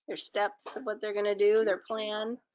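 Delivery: plain reading voice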